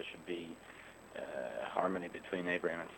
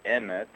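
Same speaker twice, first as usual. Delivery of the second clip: EMET said as E-met, first syllable loud; stress on first syllable; 2 semitones higher, 7 dB louder than usual. Shown as a demonstration. Speaker repeats the Hebrew word for truth, first-syllable stress. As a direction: lean hard on the E of EMET. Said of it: "with the accent on the first syllable".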